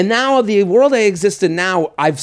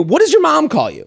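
pointed question; 10 semitones higher than usual. Retold or imitated. Host's own retold question.

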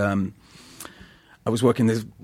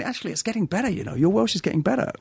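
Welsh friends; 8 semitones higher, faster than usual. Retold or imitated. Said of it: "all my Welsh friends were going".

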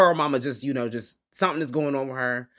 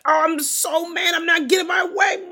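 high-pitched, hammed-up tantrum yell; mock angry outburst; 5 dB louder than usual. Retelling Pearl's moments of outbursts, high mock tantrum. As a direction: blow up like someone throwing a tantrum. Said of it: "outbursts when she's like".

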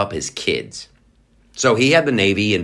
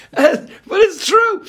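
high, slow, laughing insistence; 4 dB louder, much slower than usual. Real, not acted. Host amused at himself, laughing high voice. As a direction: insist while still laughing.

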